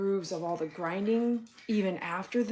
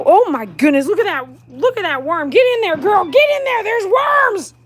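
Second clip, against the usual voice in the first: very high, loud cries to the dog; excited urging; 12 semitones higher, 13 dB louder than usual. Real, not acted. Owner urging her dog into the dirt, very high excited cries. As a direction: shriek her on toward the worms.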